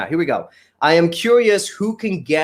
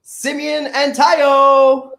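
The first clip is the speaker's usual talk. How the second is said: high, drawn-out names, building in volume; announcer's introduction; 10 semitones higher, 4 dB louder, much slower than usual. Real, not acted. Host welcoming two guests to the studio, announcer's introduction. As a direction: call the guests in like a show announcer.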